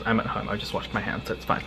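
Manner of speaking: normal talking voice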